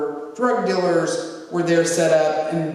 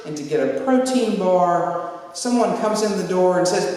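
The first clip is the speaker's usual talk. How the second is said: normal talking voice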